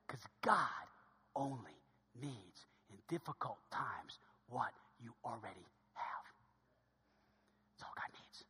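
slow, hushed, low delivery; near-whisper. Real, not acted. Preacher telling church what God needs, solemn near-whisper. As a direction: sink into a whisper, pausing between phrases. Drops to whisper for key point.

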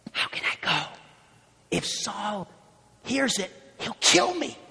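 hushed, fearful whisper. Acted. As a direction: whisper it in fear. Voicing fearful Samuel.

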